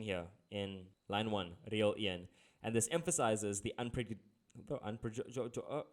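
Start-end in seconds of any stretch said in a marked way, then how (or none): 3.6-5.8 tripping over a long word; stuck mid-word, halting false starts; stuttering. Real, not acted. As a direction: stumble and restart on the syllables. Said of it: "I have a bad stutter".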